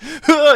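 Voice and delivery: a sudden high laugh breaking out; burst of laughter. Real, not acted. Laughing out loud at the dad's reaction clip, high burst of laughter.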